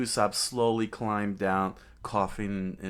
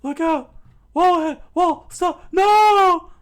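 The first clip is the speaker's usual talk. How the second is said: very high, very loud frantic screams; terrified screaming; 15 dB louder, slower than usual. Acted. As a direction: scream warnings as you're dragged. Dragged towards a wall.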